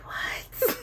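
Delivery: whispered, disbelieving "what"; hushed shock, barely voiced; stunned what. Acting out shocked reply to classmates.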